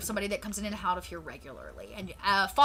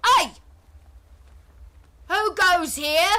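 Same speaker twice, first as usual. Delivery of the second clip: high, loud Cockney call; Walcott Savage Soul Byron; 8 semitones higher, 8 dB louder, slower than usual. Acted. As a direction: ring out in a British Cockney voice. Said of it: "Cockney voice rings out".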